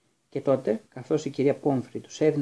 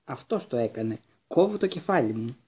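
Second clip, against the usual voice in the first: plain reading voice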